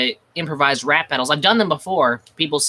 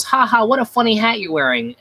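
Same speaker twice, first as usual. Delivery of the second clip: higher, hammy mocking laugh; a hint of a gleeful executioner; 8 semitones higher than usual. Executioner mocks the cat's hat before pulling the lever. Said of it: "one of the executioners goes".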